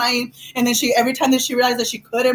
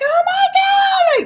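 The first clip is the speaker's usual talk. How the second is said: high mock scream; 4 dB louder than usual. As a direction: wail it at the top of the range.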